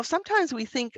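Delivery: normal talking voice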